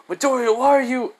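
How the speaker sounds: high, loud astonished question; acted cry of surprise; startled outcry. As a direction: call out in disbelief, pitch way up. Startled character calls out in story.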